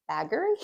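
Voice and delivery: Baggery sounded out as spelt, with a hard g; Baggery read phonetically, g fully sounded; phonetic pronunciation. Shown as a demonstration. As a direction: say Baggery letter by letter as an English reader would. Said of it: "if you're pronouncing it phonetically".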